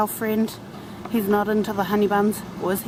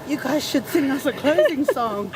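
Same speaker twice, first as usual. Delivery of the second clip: imitating him through a laugh; mock request broken by laughter; laughing quote; 4 semitones higher than usual. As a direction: quote him while giggling. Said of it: "he's like".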